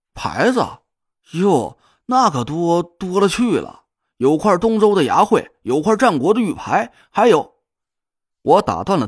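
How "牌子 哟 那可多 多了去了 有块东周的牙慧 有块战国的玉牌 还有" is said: slow tipsy bragging; slurred, drunken boasting; drunk drawl. Acted. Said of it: "胡磊的声音听起来不怎么清醒".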